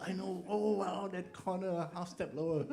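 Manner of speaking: high, laughing excited reply. Retold or imitated.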